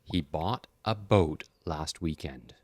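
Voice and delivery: slow, clear repeat-after-me reading; slow model sentence. Shown as a demonstration. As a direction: model it slowly for learners. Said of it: "I'm gonna say the sentence".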